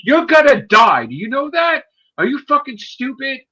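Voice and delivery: high-pitched scolding, loud at the start; retold angry berating. Officer berating him for catching thieves, angry warning. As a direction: hammer the warning home, exasperated.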